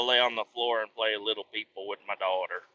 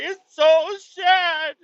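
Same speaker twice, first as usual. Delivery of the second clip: very slow, much higher, louder whimpering cry; wailing kid; 6 dB louder, much slower than usual. Retold or imitated. Dad imitating his son crying over the fake animal videos. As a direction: stretch it out like a sobbing toddler.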